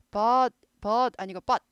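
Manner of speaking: but cut off hard, drawn out slowly and loud; but clipped with a sharp stop; staccato but demo. Shown as a demonstration. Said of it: "but 하고 딱 끊어요".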